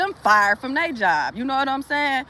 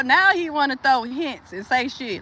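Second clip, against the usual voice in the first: normal talking voice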